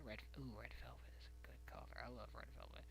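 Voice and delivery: dreamy whispered aside; soft whispered love for red velvet. Real, not acted. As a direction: whisper it like a guilty craving.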